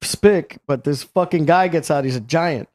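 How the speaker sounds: ordinary talk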